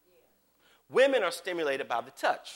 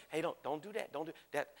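soft, much quieter, kindly caution; gentle quiet warning; 2 semitones lower, 12 dB quieter than usual. Retold or imitated. Holy Spirit gently warning the preacher, soft and kind. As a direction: warn them softly, like a patient friend. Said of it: "he's just gonna say".

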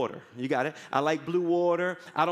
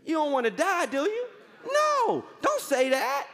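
mock surprise; 7 semitones higher than usual. Pastor teasing the complaining listener.

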